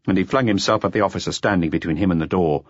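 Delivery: ordinary delivery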